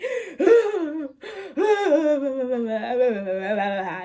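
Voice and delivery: loud, long stuttering wail; mock fit. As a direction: babble and wail like someone possessed. Acting out friend's fit.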